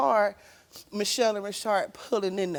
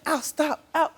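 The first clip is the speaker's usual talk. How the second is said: high, loud plea to stop; retold pleading cry; pleading yell; 7 semitones higher, 5 dB louder than usual. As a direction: beg him to stop, raised and high. Retelling pleading with abuser.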